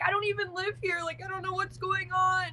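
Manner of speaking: high, innocent protest to the police; mock pleading. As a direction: play the scared innocent bystander.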